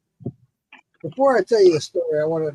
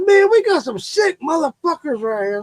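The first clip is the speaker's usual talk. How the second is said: neighbor ranting; 9 semitones higher than usual. Retold or imitated. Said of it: "He said".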